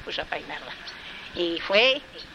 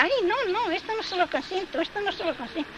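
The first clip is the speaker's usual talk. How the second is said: much higher, fading protest; indignant queen; 12 semitones higher than usual. A narrator's reading. Queen protesting the king's behaviour, high and indignant. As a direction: squeal your outrage, then trail off.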